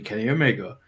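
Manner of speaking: normal talking voice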